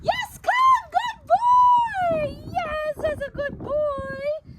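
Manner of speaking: high, loud, gushing dog praise; loud high-pitched happy praise for a dog; super excited praise. Shown as a demonstration. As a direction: sound thrilled he came to you. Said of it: "I wanna get super excited".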